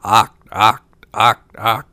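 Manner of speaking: loud, short frog croaks; punchy frog cries with gaps between; croaking frogs. A narrator's reading. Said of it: "but still cried".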